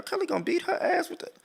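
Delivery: high, soft laughing squeal. Retold or imitated. Joking about what he said on seeing her, laughing. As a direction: squeeze it out high through laughter.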